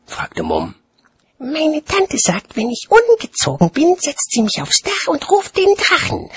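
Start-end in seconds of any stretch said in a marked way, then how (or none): 1.4-6.3 put-on very high-pitched little-kid voice; chattering child. A narrator's reading. Child repeating aunt's threat to watchman, high childish chatter. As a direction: prattle on like a small child. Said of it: "plauderte das Kind".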